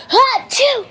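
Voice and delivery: loud high fake sneeze. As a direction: sneeze right at him. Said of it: "make myself sneeze".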